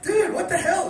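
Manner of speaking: high-pitched incredulous protest; retold shock. Finding his mate curled on the ground, incredulous. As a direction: replay alarm at a drunk mate.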